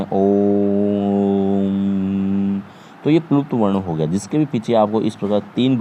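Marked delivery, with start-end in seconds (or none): 0.0-2.6 Om held long on one steady pitch; prolonged Om. Shown as a demonstration. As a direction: stretch ओम out as a long chant. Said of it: "ओम का उच्चारण करते हैं". (ओम को लंबा खींचकर एक सुर में बोलें)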